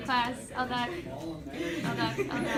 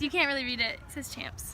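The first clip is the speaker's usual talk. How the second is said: ordinary talk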